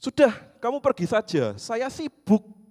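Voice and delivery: put-on high, loud old lady shooing him off; imitating a furious grandma; angry old woman. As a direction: chase him away in a fierce grandma voice. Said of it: "semakin keras nadanya".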